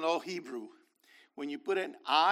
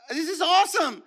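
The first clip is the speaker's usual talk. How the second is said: laughing with delight; 12 semitones higher, 9 dB louder than usual. Real, not acted. Preacher delighted by the scene.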